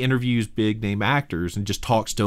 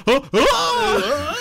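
imitated weird laugh. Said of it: "he's like".

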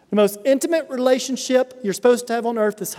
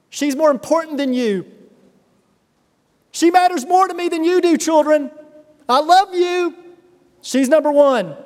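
higher and louder, insisting wife comes first; emphatic dad; 6 semitones higher, 5 dB louder than usual. Retold or imitated. Dad at the dinner table telling children, raised and emphatic. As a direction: proclaim it to the kids with force. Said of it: "and I'd say".

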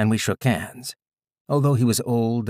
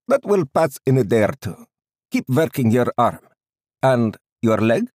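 put-on loud, raised Indian-accented doctor; thick Indian accent; 4 semitones higher, 7 dB louder than usual. A narrator's reading. Doctor checking stiff limbs, loud thick Indian accent. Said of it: "he said in a thick Indian accent".

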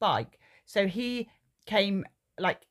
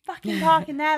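higher, louder shout at the driver; retold angry shout; 9 semitones higher, 6 dB louder than usual. Mother yells at the parked driver, loud and high. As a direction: yell it across the street. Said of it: "I literally was like".

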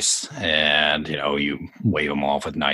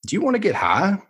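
character voice; 3 dB louder, faster than usual. Acted. Character line in book reading.

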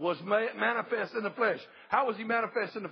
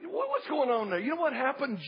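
ordinary delivery

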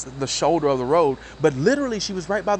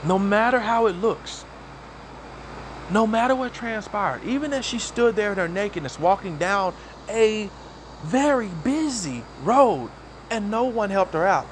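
preaching; 5 semitones higher than usual. Acted. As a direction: lift each phrase like a preacher. Preaching about the woman.

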